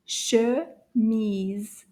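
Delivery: "Chemise" drawn out with a gap between syllables; slow syllables. Shown as a demonstration. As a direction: say "Chemise" slowly, one syllable at a time. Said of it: "say it again a little faster".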